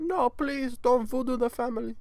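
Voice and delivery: high-pitched mock begging; pleading victim. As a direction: beg in a very high put-on voice. Acts victim begging the trafficker.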